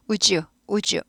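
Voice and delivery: "would you" run together as woo-joo; "would you" with a smooth j; softly blended. Shown as a demonstration. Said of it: "부드럽게 발음되죠".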